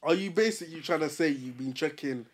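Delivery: ordinary delivery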